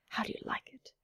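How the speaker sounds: whisper. Acted. Shy question to robot.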